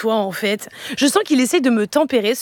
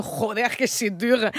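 put-on Spanish accent; 2 semitones lower, 5 dB quieter, slower than usual. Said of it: "je me mets à parler comme ça".

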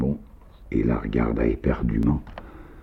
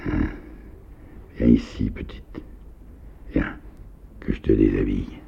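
murmured softly; 3 semitones lower, 4 dB quieter than usual. A narrator's reading. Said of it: "Nikita murmura doucement".

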